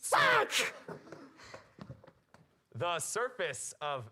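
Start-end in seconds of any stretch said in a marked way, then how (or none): 0.0-0.5 high, loud yelp; loud shout. Real, not acted. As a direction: blurt it out loudly. Host ends scene.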